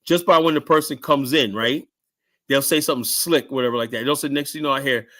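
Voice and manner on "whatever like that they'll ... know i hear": ordinary delivery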